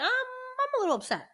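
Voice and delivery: squeaky high mock-upset voice, far above her own. Mother telling the podcast host how she sulked in a high mock-upset voice. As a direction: pitch it way up like a sulky kid. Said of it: "I was like".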